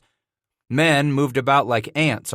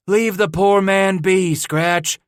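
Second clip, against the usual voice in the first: laughing trapper; 9 semitones higher, 5 dB louder, slower than usual. A narrator's reading. Caleb calling out laughing.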